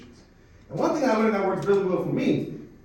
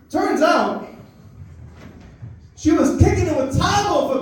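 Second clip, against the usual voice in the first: excited retelling; 7 semitones higher, 4 dB louder than usual. Real, not acted. Excitedly retelling mom's beating.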